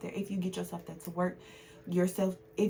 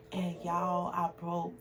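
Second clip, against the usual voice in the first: normal talking voice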